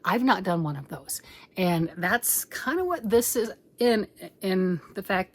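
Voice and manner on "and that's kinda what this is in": ordinary talk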